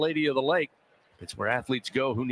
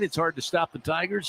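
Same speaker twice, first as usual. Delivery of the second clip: ordinary talk, nothing marked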